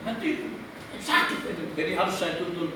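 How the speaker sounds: ordinary delivery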